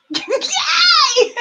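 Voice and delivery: high, loud laughing squeal of triumph; excited cheer. Real, not acted. Player told her guess is right, excited cheer. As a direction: squeal with delight.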